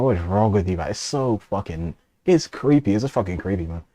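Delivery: ordinary delivery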